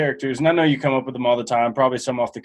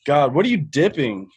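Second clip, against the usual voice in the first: ordinary delivery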